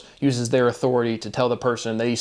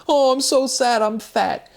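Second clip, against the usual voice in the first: much higher, mocking whiny falsetto; mock whining cop; 12 semitones higher, 3 dB louder, slower than usual. Mocking the crying cop on the stand in a whiny falsetto. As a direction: mimic her sobbing self-pity as a joke. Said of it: "she's up there whining and crying".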